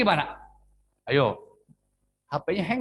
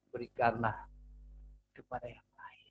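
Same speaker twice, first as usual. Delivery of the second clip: whisper; 6 semitones lower, 10 dB quieter than usual. Acted. Preacher drops to a whisper.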